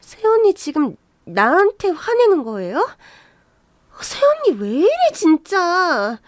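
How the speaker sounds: high-pitched, whiny protest; affronted, stretching out the final word; indignant disbelief. Acted. Sister-in-law protesting at being snapped at, indignant.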